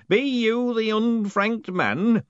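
put-on angry old postman. A narrator's reading. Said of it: "looking angry".

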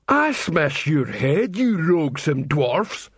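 slow, lumbering troll threat; troll snarling at the dwarfs, slow; threatening troll. Acted.

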